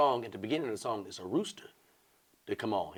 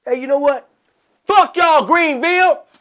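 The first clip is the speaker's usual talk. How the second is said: loud high parting curse; mock furious exit; angry yell; 15 dB louder than usual. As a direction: storm off yelling. Comic curses crowd.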